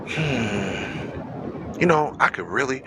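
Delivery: low, quiet, unhurried musing; calm inner thought. Retold or imitated. Jesus calmly thinking to himself before his accusers, low and quiet. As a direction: muse to yourself, totally unbothered. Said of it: "he's just sitting there chilling like".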